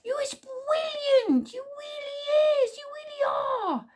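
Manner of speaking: very high, excited squeal; Squeeze Juice. A narrator's reading. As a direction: gush adoringly in a tiny squeaky voice, drawn out. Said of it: "squealed Squeeze Juice excitedly".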